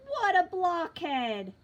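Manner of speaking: loud, slow scornful exclamation; exasperated shout. Acted. Lucy mocking Charlie Brown.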